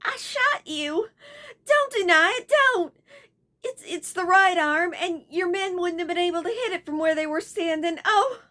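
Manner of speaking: high, tearful, breaking voice. A narrator's reading. Beverly tearfully confesses she shot Baldos. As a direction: confess through tears. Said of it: "her voice broke".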